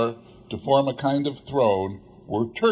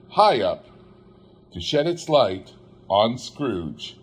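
plain reading voice

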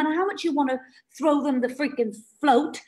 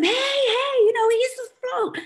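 pitched way up, calling to someone in the water; higher, urgently offering the float; calling out to someone drowning; 11 semitones higher than usual. Pretend. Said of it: "and you say to them".

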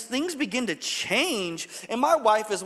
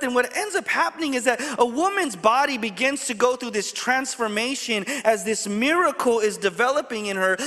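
normal talking voice

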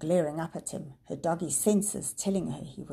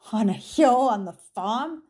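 slow rising incredulous echo; disbelieving; 4 semitones higher, 8 dB louder than usual. A narrator's reading. Professor echoes in disbelief, slow and rising. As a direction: doubt every word. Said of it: "Prof Gamal sounded disbelieving".

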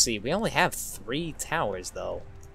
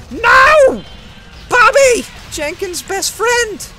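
very high, loud wailing; hammed-up grief at full volume; mock anguished scream; 11 dB louder, slower than usual. Player mourns Jenkins' best friend, melodramatic screaming.

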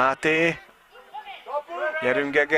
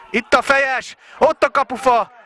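much higher and louder; excited hungarian sports commentary; 12 semitones higher, 5 dB louder than usual. Real, not acted. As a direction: yell the chance as it happens. Commentator at a header off post.

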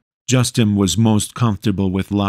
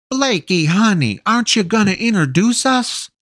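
giddy mother; 13 semitones higher than usual. A narrator's reading. Giddy mother greets son's friends.

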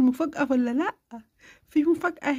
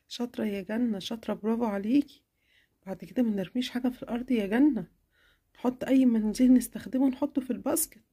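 lower, warm approving mom voice; mother praising her daughter, warmly; 6 semitones lower than usual. Acted.

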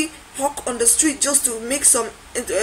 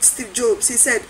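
ordinary talk

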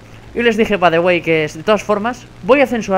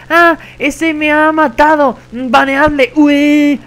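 loud, high, drawn-out mock wailing; mock crybaby; 8 semitones higher, 7 dB louder, much slower than usual. Mocking a kid posting on War Thunder forums, mock crybaby. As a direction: imitate a tattling child bawling for a ban. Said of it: "llorando y quejándote".

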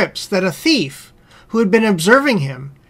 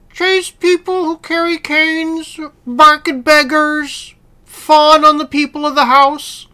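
higher-pitched persuasive dog voice; well-fed house dog; 7 semitones higher than usual. Acted. House dog advising the hungry wolf, higher persuasive voice. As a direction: coax in a raised character voice.

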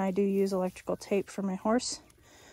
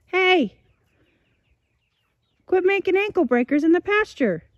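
shouting; 8 semitones higher, 6 dB louder than usual. Real, not acted. Calling out to dog again, louder.

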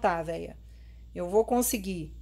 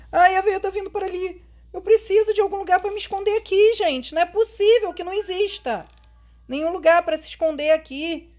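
panicked; 7 semitones higher, 4 dB louder than usual. Real, not acted. Granny coming, seeking hiding place.

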